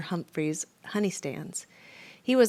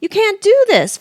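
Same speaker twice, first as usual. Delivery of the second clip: much higher, loud outcry; protest; 12 dB louder than usual. A narrator's reading. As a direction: protest in disbelief. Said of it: "Freddy protested".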